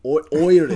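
"Irish" loudly opened on "oi". Shown as a demonstration. Co-host demonstrates the accent, "Irish" starting like "oi". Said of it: "sounds like you're saying oi".

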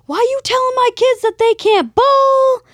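high, loud furious dad yelling at staff; angry yelling. Retold or imitated. Angry father yelling at the bowling attendant over his kids, furious shout. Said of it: "starts yelling".

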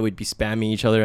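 ordinary delivery

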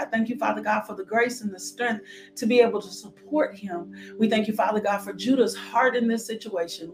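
ordinary delivery

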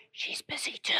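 put-on hushed whisper of a small kid; secretive little whisper. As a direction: whisper it like a child in hiding. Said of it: "Why are you whispering".

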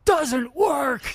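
sudden high, loud shout; screaming. Retold or imitated. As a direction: bark it right in his face. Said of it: "screams at me like that".

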